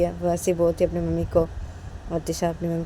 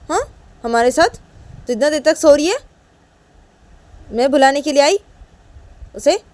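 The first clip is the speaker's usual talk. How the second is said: scolding mother, higher and louder; angry mother; 8 semitones higher, 11 dB louder than usual. Acted. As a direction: tell her off, sharp and rising. Mother scolds, admits anger.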